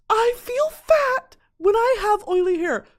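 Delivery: high, slow whimpering laugh; laugh-crying. Real, not acted. Laugh-crying as she repeats the TikTok line.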